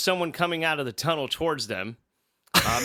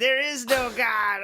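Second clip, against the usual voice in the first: mock yell; 7 semitones higher, slower than usual. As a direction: bellow it like a crazed prophet. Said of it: "The blind lady yells down at her".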